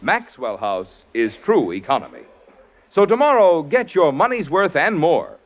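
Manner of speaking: ordinary talk, nothing marked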